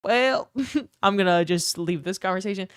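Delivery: laughing through the line; half-laughing, backing out of the chat; amused retold reply; laughing awkward exit. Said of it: "I was like".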